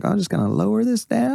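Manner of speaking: high, timid mock voice; high meek imitation. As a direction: imitate a scared driver turning music down. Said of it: "he's like".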